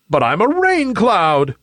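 high, whiny cloud protest; high-pitched plaintive whine; moaning. A narrator's reading. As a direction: moan it like a little one upset. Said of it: "moaned Nimbus".

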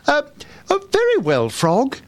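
high, hesitant princess. A narrator's reading. Princess pretends to agree with the frog, hesitant. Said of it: "she said out loud".

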